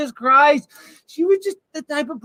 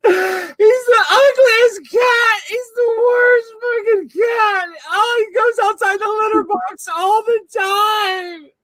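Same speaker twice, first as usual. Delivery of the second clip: fake sobbing; 6 semitones higher, slower than usual. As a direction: wail it out in mock grief. Fake crying over cat photo.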